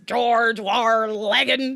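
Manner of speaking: loud, very slow rolling British growl of an impression; hammed-up British character shout; British accent, imitated. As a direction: stretch it out like Yosemite Sam gone British. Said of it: "he says it like this".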